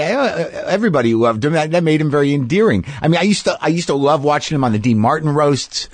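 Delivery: normal talking voice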